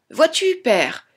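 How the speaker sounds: put-on high, proud child's call; little Tom calling out. A narrator's reading. Tom spots his father in the forest, high childlike call. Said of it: "il lui cria".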